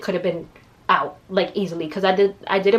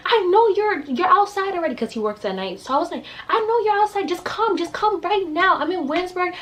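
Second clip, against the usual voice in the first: urgent call to dad; 10 semitones higher than usual. Retold or imitated. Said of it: "I'm like".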